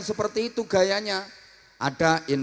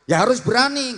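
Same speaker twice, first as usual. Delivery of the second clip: ordinary delivery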